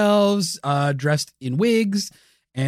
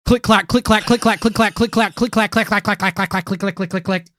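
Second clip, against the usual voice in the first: vocal click clack typing noises; typing sounds; 3 semitones higher than usual. Pretend. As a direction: rattle off click and clack like keys. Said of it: "type in some things here".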